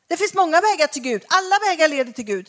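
mock enthusiastic seeker; lightly voiced seeker; imitating seekers. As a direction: play the confident new age believer. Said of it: "Vem kan säga så idag".

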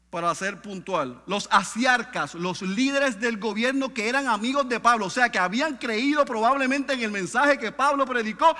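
high, loud emphatic preaching; preaching at full force. Acted. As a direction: hammer each phrase to the people. Preaching the story loudly.

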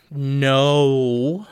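usual speaking voice

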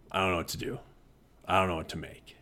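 lightly voiced student at a loss; soft, low mumbled student complaint; struggling student. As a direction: quietly imitate a stuck student. Voicing struggling students, lower and softer.

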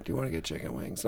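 low, very soft coaxing ask; hushed, low mock pleading; girlfriend insisting. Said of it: "She's like, "Come on, seriously".

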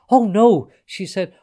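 high, loud cry of dismay; aghast. A narrator's reading. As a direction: exclaim in horror at a mistake. Fairy aghast at the pterodactyls.

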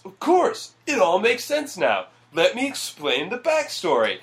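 high mock eureka with a push; hammy movie epiphany. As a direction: ham up the big revelation. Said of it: "that is literally his response".

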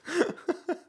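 high-pitched laugh. Real, not acted. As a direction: burst into a short, high laugh. Laughs at co-host's joke.

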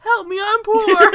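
high, loud, drawn-out mock plea; hammed-up wail on the edge of laughter; Kristen Wiig. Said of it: "like, Kristen Wiig in Bridesmaids".

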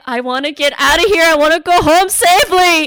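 high, loud, rising desperation; retold panicked wish. Child stuck in a dark graveyard, loud high panicky plea.

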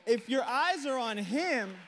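slow, high and loud, leaning on eyes; emphatic preaching. Real, not acted. Preacher pressing the point on the church, slow and emphatic. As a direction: draw it out so it lands. Preacher repeats the line slowly.

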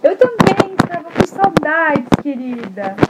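high, slow granny voice; fond grandma. Acted. Grandma answering her granddaughter, high and fond. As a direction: tell her she missed her. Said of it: "a vovozinha falou assim".

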